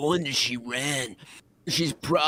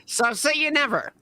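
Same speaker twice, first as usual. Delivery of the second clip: higher, fast hammed-up villain threat; mock-menacing letter, higher and rushed; dramatic letter reading; 6 semitones higher, faster than usual. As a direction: read the threat like a cartoon villain.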